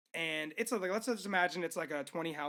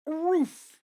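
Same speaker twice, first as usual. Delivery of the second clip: high, loud word barked like a woof; talking dog; 9 semitones higher, 7 dB louder than usual. Retold or imitated. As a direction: bark the answer out as the dog. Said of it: "Dog, what is on the top of a building".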